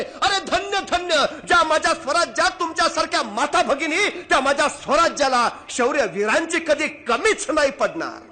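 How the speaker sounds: loud, high, triumphant proclamation; the king rising in admiration; exalted king. Acted. (उत्स्फूर्त राजाची मोठ्याने घोषणा)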